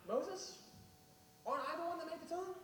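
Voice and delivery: high, fast exasperated rebuke; exasperated God. Acted. God scolding Moses for doubting him, high and quick. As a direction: rattle it off, high and impatient.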